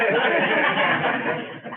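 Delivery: short breathy laugh; amused laugh. Real, not acted. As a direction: laugh at your own joke. Laughing at her own joke.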